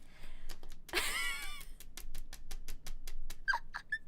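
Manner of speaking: very high, helpless wheezing laugh; real, breathless laughing fit. Real, not acted. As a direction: lose it in a long wheeze. Laughs at the awful book.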